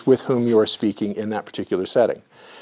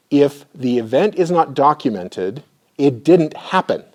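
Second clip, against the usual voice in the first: slow emphasis; 3 semitones higher, slower than usual. Shown as a demonstration. Stressing the documentation rule.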